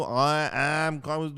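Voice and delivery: I am coming said slowly, word by word; slow, deliberate English; said clearly. Shown as a demonstration. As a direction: spell out I am coming slowly and carefully. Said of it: "خب بگو".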